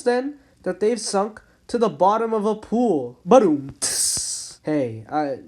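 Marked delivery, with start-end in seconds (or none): 3.2-3.7 loud vocal drum sting; vocal rimshot. Pretend. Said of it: "just a little dad joke there". 3.8-4.6 whispered cymbal tss; cymbal hiss. Pretend. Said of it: "just a little dad joke there".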